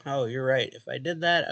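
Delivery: normal talking voice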